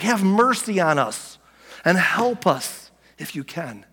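slow, drawn-out begging for mercy; acted desperation, each plea stretched out; desperate plea. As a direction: act out a father pleading for help. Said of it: "Can you hear the desperation in his voice?".

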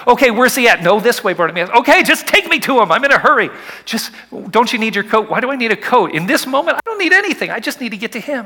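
eager excited dialogue. Retold or imitated. Bartimaeus urging his handlers toward Jesus, excited. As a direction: rush the words, impatient with joy.

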